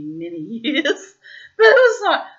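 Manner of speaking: giggling through the words; laughing. Real, not acted. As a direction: voice breaking into laughter. Laughing at her own confession.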